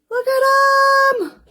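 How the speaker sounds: high, loud, slow squealing gush. Real, not acted. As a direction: coo over them with a high loud gush. Gushing over earrings.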